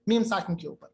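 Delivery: usual speaking voice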